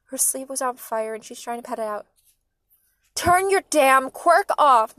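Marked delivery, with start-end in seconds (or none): 3.2-5.0 slow, very loud, higher-pitched command; furious drawn-out shout; yelling. A narrator's reading. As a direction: yell each word with force. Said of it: "Mom yelled".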